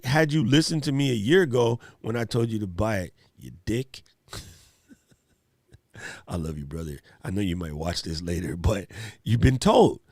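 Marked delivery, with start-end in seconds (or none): 3.4-7.0 soft, low laughing affection; laughing tease. Real, not acted. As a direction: laugh it out gently to a friend. Teasing a friend, laughing softly.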